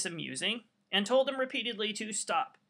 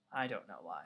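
much lower, softer deadpan throwaway; sarcastic aside; 14 semitones lower, 9 dB quieter than usual. Acted. Teacher to class about the unruly colonists: low deadpan sarcasm. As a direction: drop the voice, dryly ironic.

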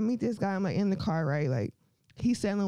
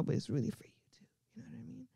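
fast, soft secret whisper; 9 dB quieter, faster than usual. Real, not acted. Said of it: "I'm giving y'all a secret".

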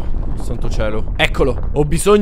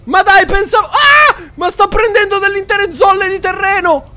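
high loud alarmed yelling; panicked shouting; 8 dB louder than usual. Real, not acted. Mostro lo raggiunge. (urla acute in preda al panico)

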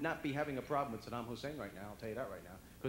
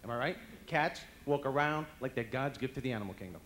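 normal talking voice

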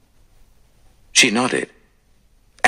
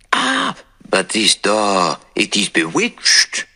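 angry cry; slower than usual. Acted. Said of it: "cried Poirot angrily".

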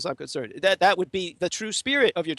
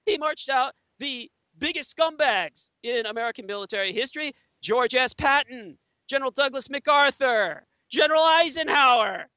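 heated rant; 10 semitones higher than usual. Real, not acted. Heated denunciation of generals.

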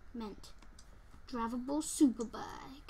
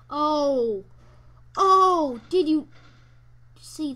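surprised shout; 2 semitones higher, 15 dB louder, much slower than usual. Real, not acted. Shocked by near death.

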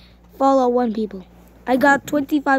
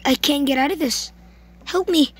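normal talking voice

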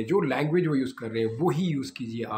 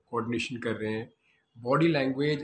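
ordinary delivery

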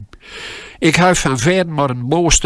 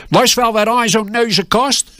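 boss roaring; 9 semitones higher than usual. Retold or imitated. Boss roars at packer about the nose's cost. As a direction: roar it at the trembling boy. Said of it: "brulde mijn boos".

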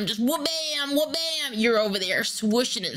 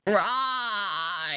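goat-like yell. Acted. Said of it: "Why you are doing like a goat?".

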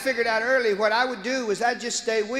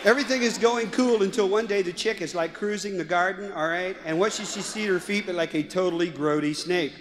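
put-on valley girl; 3 semitones lower than usual. Daughter retelling Genesis to her friend, valley girl voice. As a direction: lower valley girl storyteller. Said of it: "a valley girl".

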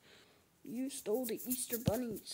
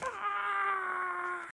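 higher, louder sudden shout of pain; loud pained cry; 8 semitones higher, 6 dB louder than usual. Acted. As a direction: yelp as if struck. Cry when something goes wrong.

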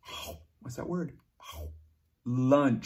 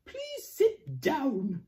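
stern slow teacher; 9 semitones higher, slower than usual. Acted. Teacher voiced in story.

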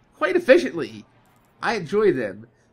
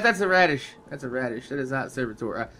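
ordinary delivery

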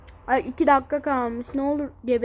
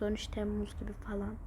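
muttering quietly; 4 semitones lower, 11 dB quieter than usual. Real, not acted.

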